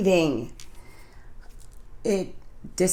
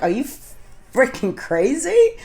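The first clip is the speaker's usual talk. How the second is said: are-you-kidding retort, pitched up and stretched; retold incredulity; 7 semitones higher than usual. Told to hit another meeting, retold incredulous reply.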